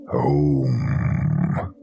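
low, drawn-out monster moan; guttural creature. A narrator's reading. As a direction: stretch it out low, like a thing in the woods. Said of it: "came a single guttural word".

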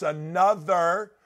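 very slow, drawn-out words; slow and deliberate, with weighty pauses; saying it slowly. Real, not acted. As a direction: stretch each word out for emphasis. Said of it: "I wanna say it slowly".

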